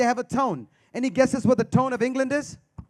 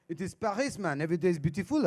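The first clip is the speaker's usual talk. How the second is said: put-on French accent; 4 semitones lower, 4 dB quieter than usual. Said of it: "He goes".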